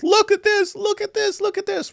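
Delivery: very high, mocking squeal of outrage; shrill caricature of online outrage; mock outrage posters. Host mocking social media posters, very high mock squeal. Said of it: "blast social media with".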